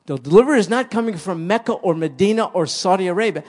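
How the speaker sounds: loud and fast down the list; preacher pounding the list of places; fervent rapid preaching. Acted. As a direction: rattle off the places. Preaching at full force.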